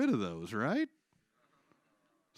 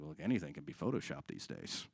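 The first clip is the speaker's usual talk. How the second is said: low dismissive denier; 10 semitones lower, 8 dB quieter than usual. Acted. Denier voice, much lower.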